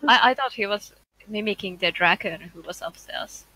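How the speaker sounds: ordinary delivery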